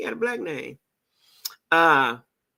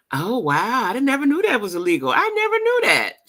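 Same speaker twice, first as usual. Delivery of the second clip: much higher, louder astonished exclaim; genuinely stunned by the news; real surprise; 7 semitones higher, 4 dB louder than usual. Real, not acted. Learning pepper spray laws on stream, astonished and much higher.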